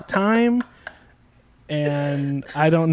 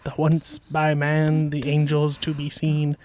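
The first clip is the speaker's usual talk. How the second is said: poem recitation; 2 semitones lower, 4 dB quieter, faster than usual. Acted. Dramatic poem recitation over humming.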